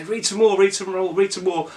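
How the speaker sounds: high-pitched mock child pleading; desperate kids begging. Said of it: "your kids are gonna desperately say".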